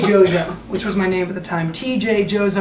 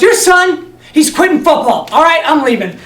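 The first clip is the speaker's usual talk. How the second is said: loud, higher, curt drop-the-news; blunt grandpa; 6 semitones higher, 6 dB louder than usual. Retold or imitated. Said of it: "my grandpa goes".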